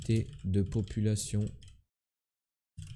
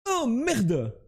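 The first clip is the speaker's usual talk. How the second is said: startled curse; 11 semitones higher than usual. Real, not acted. Realises he mixed up countries.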